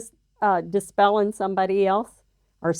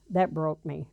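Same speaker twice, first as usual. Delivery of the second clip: ordinary talk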